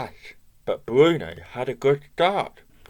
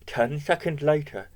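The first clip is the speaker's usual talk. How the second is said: plain reading voice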